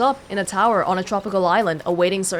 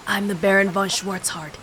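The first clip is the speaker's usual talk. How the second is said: gasped. Acted. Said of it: "he gasped".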